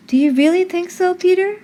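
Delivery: put-on a young girl asking shyly; girlish eager question. A narrator's reading.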